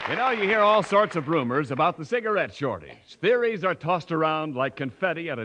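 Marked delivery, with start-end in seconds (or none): none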